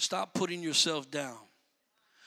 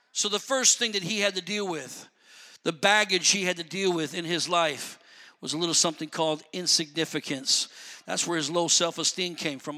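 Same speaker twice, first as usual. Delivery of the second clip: usual speaking voice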